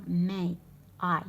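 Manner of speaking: ماي rhyming with English "my"; ai diphthong. Shown as a demonstration. Teacher repeats the example for learners, ماي with clear ai. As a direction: say ماي with an open a gliding into i. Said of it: "يتنطق اي".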